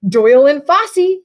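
a hint of a formal phone greeting; best scientific voice. A narrator's reading. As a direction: answer the phone like a serious young scientist. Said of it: "speaking in his best scientific voice".

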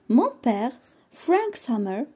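ordinary delivery

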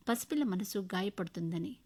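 ordinary delivery, nothing marked

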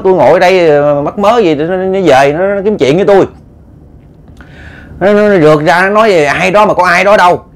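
high, indignant retold complaint; acted-out aggrieved wife; hot-tempered wife. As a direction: protest heatedly, stumbling over repeated words. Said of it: "cũng nóng theo kiểu của bả".

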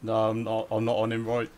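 ordinary delivery

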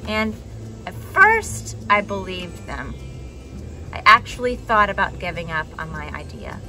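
plain reading voice

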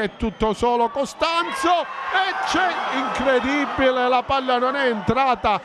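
breathless italian goal commentary. Real, not acted. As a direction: scream the late goal. Late goal, commentator erupts.

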